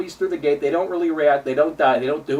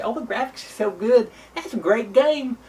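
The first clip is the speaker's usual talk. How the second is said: mocking normie gamer; 6 semitones higher than usual. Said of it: "they look at this and say".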